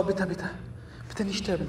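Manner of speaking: ordinary talk, nothing marked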